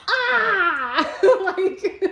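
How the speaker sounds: high, loud laughing mock cry; mock wail. Teasing friend mimics a helpless wail, laughing. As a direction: squeal it like a half-crying whine. Mocking partner's reaction.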